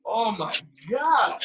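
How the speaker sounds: high laughing outburst; real laughter. Real, not acted. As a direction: crack up at the memory.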